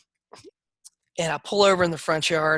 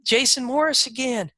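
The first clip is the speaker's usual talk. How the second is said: bad agent call; 7 semitones higher than usual. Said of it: "they say".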